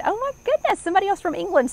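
high, delighted exclamation at a new viewer; excited squeal. Real, not acted. Host greeting a viewer from England, high and excited.

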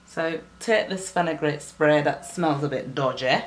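ordinary talk, nothing marked

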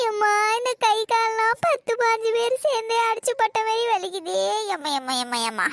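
groaning as if beaten all over, put on; faked pain moaning.

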